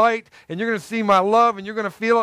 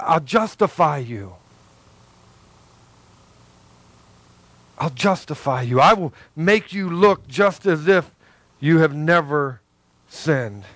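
Jesus' tender voice; 4 semitones lower than usual. Pretend. Preacher voicing Jesus to the congregation, gentle and low. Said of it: "I said".

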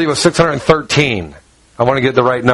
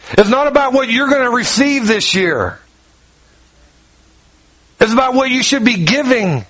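rising preaching; 7 semitones higher than usual. Acted. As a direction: lift it into an earnest preaching plea. Preaching climax on Christmas love.

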